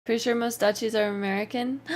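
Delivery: ordinary delivery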